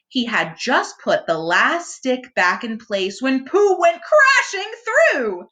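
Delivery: ordinary delivery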